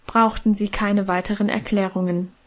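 plain reading voice

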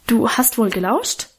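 usual speaking voice

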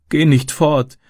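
put-on high, tearful child's plea; plaintive acted crying voice; sobbing. A narrator's reading. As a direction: beg like a little girl in tears. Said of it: "schluchzte sie".

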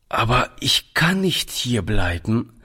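usual speaking voice

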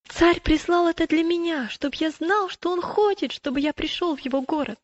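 high, enthusiastic pilgrim voice. Acted. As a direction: proudly show off a treasured invitation.

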